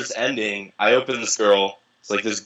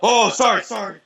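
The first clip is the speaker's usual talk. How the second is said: startled owner; 10 semitones higher than usual. Retold or imitated. Boat owner walks in.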